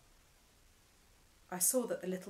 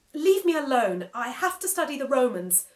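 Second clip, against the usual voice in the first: louder, higher fed-up yell; 6 semitones higher, 13 dB louder than usual. Acted. Boy yelling at the talking screen to leave him alone. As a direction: shove him away with your voice. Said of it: "I yelled at him".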